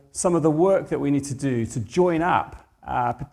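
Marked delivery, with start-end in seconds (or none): none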